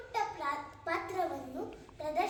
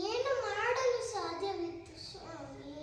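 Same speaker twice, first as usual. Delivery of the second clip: emphatic, rising-then-falling plea; helpless appeal; 3 semitones higher than usual. Acted. Sita asks her lord what she could do, anguished appeal. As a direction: throw the question out in anguish.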